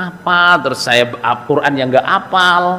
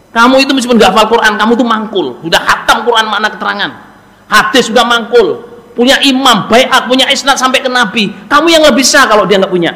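rousing pep talk; 6 semitones higher than usual. Retold or imitated. Retold mentor's rousing pep talk.

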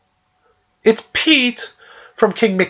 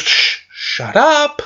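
high, slow mock hush; 8 semitones higher, much slower than usual. Disguised King Mickey hushes the crowd, high slow shush. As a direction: shush them fast in a high cartoon voice.

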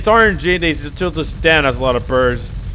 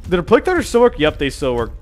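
ordinary talk, nothing marked

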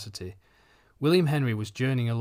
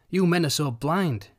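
retort; 5 semitones higher, 5 dB louder, slower than usual. A narrator's reading. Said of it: "retorted Missus Denison".

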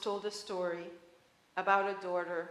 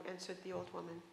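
ordinary delivery, nothing marked

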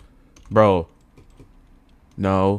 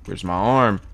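usual speaking voice

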